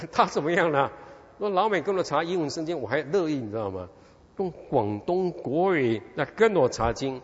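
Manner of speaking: ordinary delivery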